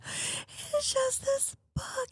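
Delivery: high, soft, slow tearful whimper; imitating crying. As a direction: replay her own sobbing voice. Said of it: "bawling in bed".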